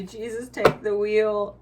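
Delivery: loud, slow hammed-up plea; mock dramatic surrender. Quoting the surrender energy, loud slow hammed-up plea. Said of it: "That's really literally the energy".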